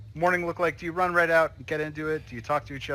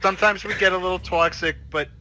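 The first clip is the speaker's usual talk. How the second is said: raised, clingy wife; lightly voiced pleading wife; 6 semitones higher, 7 dB louder than usual.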